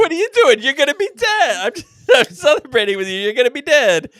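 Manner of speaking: high, loud comic protest; mock outraged yelling. Imitating the stabbed man still celebrating, mock yelling.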